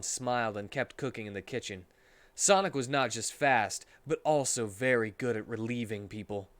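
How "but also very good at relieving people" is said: ordinary delivery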